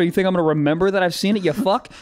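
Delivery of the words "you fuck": ordinary delivery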